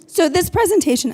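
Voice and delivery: talking around a cough drop; mouth full. Real, not acted. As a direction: say it with a lozenge in your mouth. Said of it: "I've got a cough drop in my mouth".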